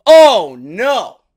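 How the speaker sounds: loud, high mock "oh no"; playful fake alarm; mock dismay. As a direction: ham up the shock for the viewers. Mock dismay at squash match.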